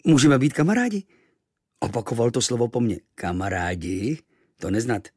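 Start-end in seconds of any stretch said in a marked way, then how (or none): 0.1-1.0 higher, eager question; boy asking the native to be friends; hopeful boy. Acted. 3.2-5.1 lower, slow wondering; puzzled native. Acted. Native repeats unknown word.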